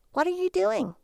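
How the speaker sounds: high, annoyed wife's question; a touch of irritation at the husband; impatient wife checking on him. Acted. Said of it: "annoyed by the small amount of attention".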